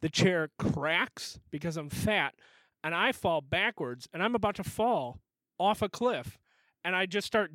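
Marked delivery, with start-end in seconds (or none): none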